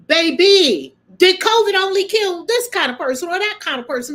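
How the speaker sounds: rising exasperation. Real, not acted. Emphatic rhetorical challenge.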